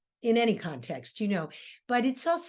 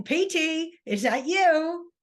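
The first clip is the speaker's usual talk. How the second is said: Meg calling again; 6 semitones higher, 4 dB louder, slower than usual. Acted. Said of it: "Then she repeats it again".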